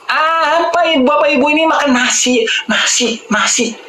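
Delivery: high-pitched incredulous teasing; mock exasperation. Doctor teasing rice-loving viewers, mock exasperated. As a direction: pitch it high, harping on one word in disbelief.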